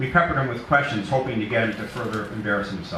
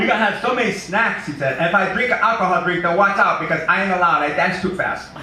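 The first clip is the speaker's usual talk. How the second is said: high, loud, giddy big simple man; excited simple Eddie; 8 semitones higher, 6 dB louder than usual. Acted. Eddie boasting to Poltoy about his party, high giddy and loud. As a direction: bubble over like a thrilled big kid.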